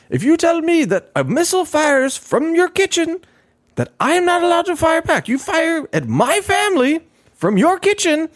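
high, loud, indignant rant in another voice; angry president. Retold or imitated. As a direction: imitate the furious president defending his strikes. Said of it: "he was getting mad at me".

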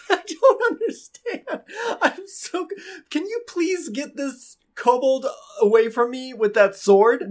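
high-pitched bewildered pleading; hammed-up helpless villager; flustered townsman. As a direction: plead in a panicky, breaking-up voice. Game master plays a flustered townsman.

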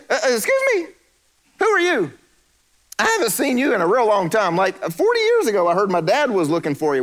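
high, scoffing king calling to his court; mocking Pharaoh. Pharaoh mocking Moses to his court, high and scornful. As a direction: ham up a sneering Pharaoh. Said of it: "And so he said".

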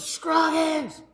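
calm him down from above; reassuring sergeant. Retold or imitated. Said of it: "he goes".

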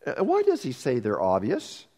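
ordinary talk, nothing marked

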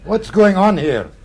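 stern owner breaking up a fuss; demanding. Acted. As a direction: boom out a challenge as you arrive. Owner storms in on disturbance.

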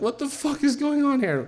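retold freak-out, voice shot way up high; anxious outburst. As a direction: squeak it out in mock panic. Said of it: "anxiety central".

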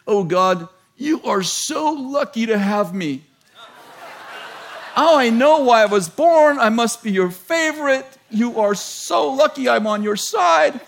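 high, loud, gloating brag to God; mock boastful prayer. Preacher mock-bragging to God at night, hammed-up smugness. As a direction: ham up the smug favorite child. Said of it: "I didn't say that. You know, I'm being a little funny".

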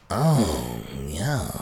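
low odd Kenny Kniff voice; weird voice imitation. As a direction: imitate the brother's strange voice. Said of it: "You sound like Kenny Kniff".

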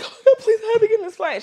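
very high, loud, hammed-up wail; mock tearful plea. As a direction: beg in a squeaky fake-crying voice. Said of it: "I'm like, in tears".

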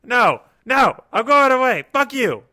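high, panicked disgust; scared and angry. Acted. Balls put on his chest.

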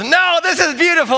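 high, bright cheerful reply; cheerful jogger. Retold or imitated. Jogger in rain gear answering dad, cheerful and high. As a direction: turn the offer down happily.

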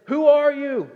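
sudden high startled cry; loud, high startled "who are you". Acted. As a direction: jolt awake and cry out. Boaz startled awake at midnight.

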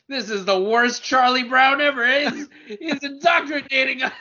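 laughing. Real, not acted. Mock complaint through laughter.

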